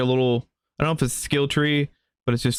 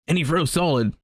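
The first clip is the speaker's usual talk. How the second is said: lightly voiced chatty villager, eh-style lilt; cheerful Canadian villager. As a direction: play the friendly folksy villager asking for help. Voices game character dialogue.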